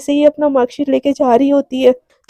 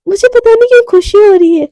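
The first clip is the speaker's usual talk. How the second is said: excited; 7 semitones higher, 5 dB louder than usual. Retold or imitated. Radhika confused but happy.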